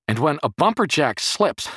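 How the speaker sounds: high, loud, exasperated lecture; furious yell. A narrator's reading. Jerry warning the boy about the jack, furious yell. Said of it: "he yelled at the boy in fury".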